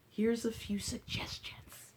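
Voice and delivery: secretive whispered aside. Acted. Teacher whispering a secret to the children. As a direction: lean in and whisper it to the class.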